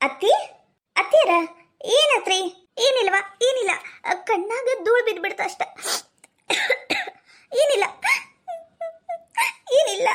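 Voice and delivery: high, tearful voice; acted weeping, brushing it off; crying. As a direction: sobs breaking between short phrases. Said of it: "ಅಳ್ಕೊಂಡ್ ಯಾಕ್ ಬಂದಿ".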